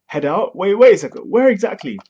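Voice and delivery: baffled protest. Acted. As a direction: stop them, confused and alarmed.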